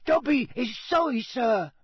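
very high, squeaky house-elf voice; anxious squeak. A narrator's reading. Dobby apologises to Harry for scaring him, anxious squeak. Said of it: "squeaked Dobby anxiously".

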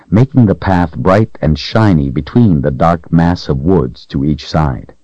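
usual speaking voice

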